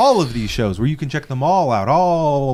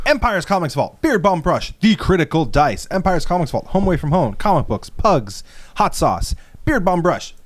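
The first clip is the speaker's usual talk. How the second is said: upbeat, pitched-up ad read; sponsor read; 4 semitones higher than usual. Acted. Host reading the sponsor list to the audience, ad-style. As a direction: rattle off the sponsors like an ad.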